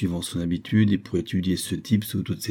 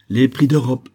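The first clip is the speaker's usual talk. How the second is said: firm, raised, loud statement; simple reply; 4 semitones higher, 6 dB louder than usual. A narrator's reading. Governor answers the protesting merchant, simply and firmly. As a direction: state it simply and finally, falling at the end. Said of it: "répondit simplement le capitaine".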